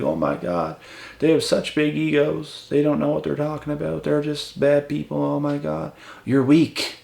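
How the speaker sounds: high, put-on whiny critic voice; mock the weak people, nasal-free but high and prissy; mimicking weak critics complaining about big egos; whiny mockery. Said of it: "be like".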